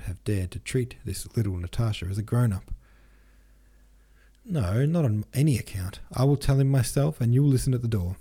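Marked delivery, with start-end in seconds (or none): 4.4-8.2 higher, louder firm insistence; emphatic refusal. A narrator's reading. Natasha will tell her suitor herself, emphatic refusal. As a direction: insist with raised voice. Daughter refuses to let mother speak.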